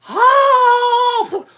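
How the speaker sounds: very high, full-blast scream; long high yell; loud scream. Retold or imitated. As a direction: scream out in mock panic on the road. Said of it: "that I screamed".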